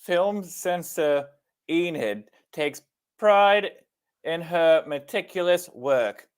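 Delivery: slow, high, badly faked British accent; over-careful British accent, word by word. Shown as a demonstration. Co-host reading the movie blurb to her friend, laboured British accent. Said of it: "grace us with your British accent".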